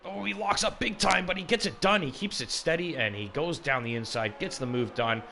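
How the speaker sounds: excited, high and loud race call; motorsport commentator. Real, not acted. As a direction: call the overtake at full excitement. Live race overtake call.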